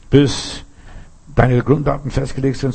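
usual speaking voice

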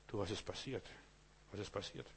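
hushed and low, a bewildered murmur; dazed hush. Acted. Preacher voicing someone just shaken awake, dazed and hushed. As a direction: ask it quietly, as if just waking up. Waking person, dazed question.

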